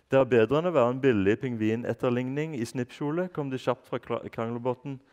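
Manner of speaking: put-on reading a children's book aloud in Norwegian; story narration. A narrator's reading. Reading a chapter aloud to listeners, lively narration. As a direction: tell the story to young listeners.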